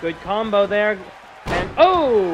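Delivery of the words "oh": loud, high burst of surprise; excited outcry. Real, not acted. Commentator reacting to a big drop, excited cry. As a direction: cry out at the sudden hit. Reacting to a sudden wrestling move.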